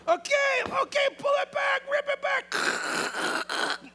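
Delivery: high, loud shouts, the last words dragged out; shouting up high. Acted. Friends on the roof shout to each other while tearing it, high loud yells. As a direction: yell to your friends while ripping the roof open.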